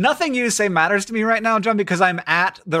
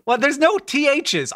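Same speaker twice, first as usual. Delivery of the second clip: ordinary delivery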